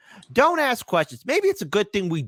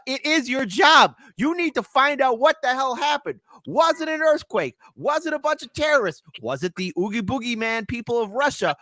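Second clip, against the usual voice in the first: much higher and louder, demanding answers; shouting rant; 11 semitones higher, 3 dB louder than usual. Real, not acted. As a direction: yell at the media to do their job. Host yells at the media.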